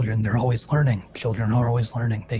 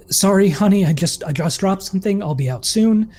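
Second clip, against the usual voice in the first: bright, higher fake-casual voice; muffled cheerful cover; 7 semitones higher, 4 dB louder than usual. A narrator's reading. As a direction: pretend all is fine to a partner. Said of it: "a muffled voice".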